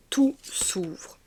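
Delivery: redit en chuchotant, sans voix; souffle-le tout bas comme un secret; chuchoté. Shown as a demonstration. Repeats the phrase, then whispers it. (chuchotement doux)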